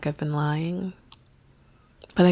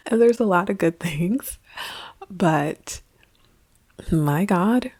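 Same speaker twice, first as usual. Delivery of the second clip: put-on Derry Girl accent; 2 semitones higher than usual. To her viewers, joking about the country, light Derry Girls accent. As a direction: slip into a playful Derry accent. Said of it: "This is my Derry Girl accent".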